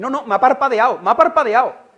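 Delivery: loud, high mock insistence, parpadeado punched; mocking believer. Preacher mocks a believer seeing the statue blink, loud. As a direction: ham up a gullible believer insisting. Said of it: "tú dices".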